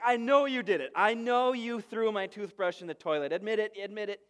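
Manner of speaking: imitating a mad sister's third degree; angry grilling. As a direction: press hard for a confession. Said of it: "she's mad, and she starts pressing her brothers real hard".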